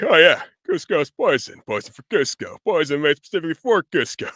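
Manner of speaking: slightly breathless henchman impression; Kronk. Shown as a demonstration. Voice actor demonstrating cartoon henchman's poison line, a little out of breath. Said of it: "sound like you're a little out of breath".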